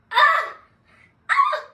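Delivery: high loud squeal of disbelief; high excited shriek; delighted scream. Real, not acted. Screams at the memory.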